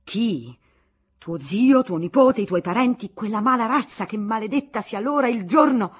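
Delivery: loud, raging outburst; furious shout. Acted. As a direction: let the fury explode. Husband cursing her relatives.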